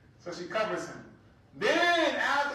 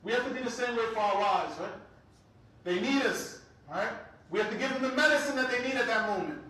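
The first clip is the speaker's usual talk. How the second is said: usual speaking voice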